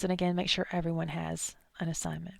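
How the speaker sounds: softly whispered reminder; hushed aside to the class. Real, not acted. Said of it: "whispered reminder".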